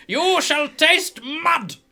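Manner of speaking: high, loud theatrical proclamation; hammed-up battle threat, shouted; boastful fighter. Roger threatening his opponent before the bout, boastful shout.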